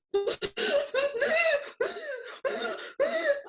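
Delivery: high-pitched hyperventilating sobs; imitated sobbing gasps, very high; bursting into tears. Handcuffed student bursting into tears, high gasping sobs. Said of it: "I burst into tears".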